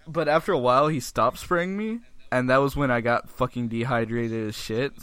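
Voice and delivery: usual speaking voice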